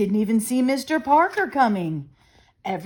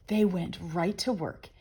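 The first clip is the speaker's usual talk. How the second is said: plain reading voice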